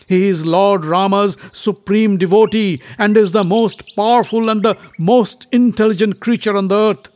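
loud, high, outraged proclamation; scold, then extol your hero forcefully; indignant. A narrator's reading. Bhima indignant, extols Hanuman.

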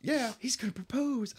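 high, hopeful mock squeal; mock excitement. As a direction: gush it as the hopeful kid, hammed-up. Excited mother and son's hopes voiced.

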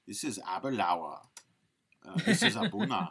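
imitating a Scottish whisky host's accent; Scottish accent, naming the scotches like a tasting video. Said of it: "my Scottish accent has devolved into".

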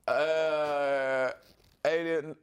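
long, drawn-out hesitant fumbling; Joe Biden. Acted. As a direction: lightly parody a president searching for words. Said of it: "Joe Biden. And he's gonna say".

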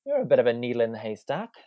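high, bright mock amazement; mock-impressed inner voice. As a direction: gush like you've struck gold. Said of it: "I was like".

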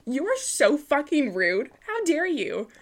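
mock furious vampire host; mock outrage. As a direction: play an offended count, blustering. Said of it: "Dracula comes in and he's like".

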